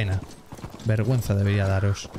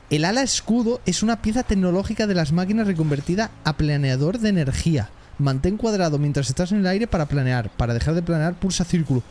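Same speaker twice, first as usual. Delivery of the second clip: reading aloud; 5 semitones higher than usual. Acted. Reads out the game tutorial.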